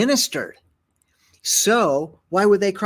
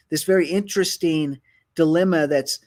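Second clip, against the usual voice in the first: ordinary talk, nothing marked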